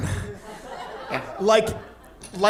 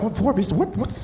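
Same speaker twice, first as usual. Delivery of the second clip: baffled; 4 semitones higher than usual. Retold or imitated. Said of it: "I'm like".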